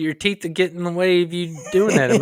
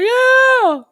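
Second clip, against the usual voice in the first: excited cheer; 7 dB louder than usual. Pretend. Mimicking splash zone crowd cheer.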